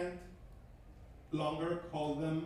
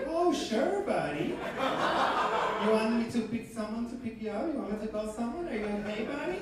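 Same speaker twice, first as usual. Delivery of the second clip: mock concerned clerk; 4 semitones higher, 4 dB louder than usual. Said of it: "The guy's gonna be like".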